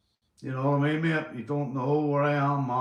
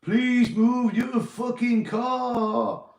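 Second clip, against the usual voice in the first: slow, stretched high yell; MC shout; 9 semitones higher, 4 dB louder, much slower than usual. Retold or imitated. Repeating Morty's club announcement, loud slow yell. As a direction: call it out over a club crowd. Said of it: "You'll remember that one, Morty".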